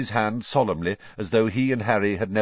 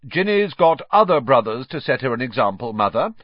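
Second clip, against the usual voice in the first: louder, higher, self-important reply; lofty; 5 semitones higher, 7 dB louder than usual. A narrator's reading. Percy to his mother about setting an example, lofty. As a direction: talk as if above them all. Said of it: "said Percy loftily".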